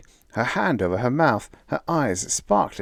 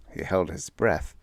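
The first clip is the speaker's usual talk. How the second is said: plain reading voice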